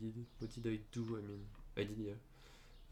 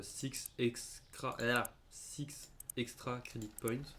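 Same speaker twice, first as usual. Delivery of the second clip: slow emphasis; much slower than usual. Real, not acted. Proud announcement.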